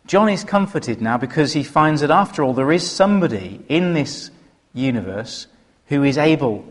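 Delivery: ordinary talk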